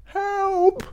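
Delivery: very high, tiny germ squeal; crying for help. A narrator's reading. Stuck cold germs call to Micro Pig, high squeaky cry. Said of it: "they cried".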